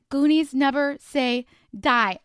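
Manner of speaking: loud, slow rallying cry; fired-up battle cry; rallying motto. Real, not acted. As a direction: shout the motto to pump yourself up. Rallying motto for race day.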